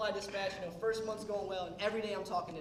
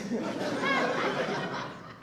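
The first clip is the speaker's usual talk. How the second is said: high, airy burst of laughter; 8 dB louder than usual. Real, not acted. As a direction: crack up at the punchline. Laughs at punchline.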